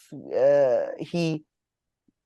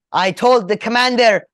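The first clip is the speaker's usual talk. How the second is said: defiant mother; 9 semitones higher, 5 dB louder than usual. Retold or imitated. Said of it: "She's like".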